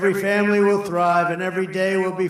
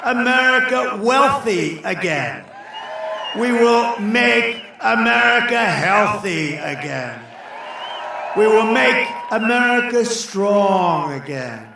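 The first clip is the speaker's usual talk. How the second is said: shouted rally refrain, rising and slower; rally speech; 3 semitones higher, 3 dB louder than usual. Real, not acted. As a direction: roar each line at the crowd.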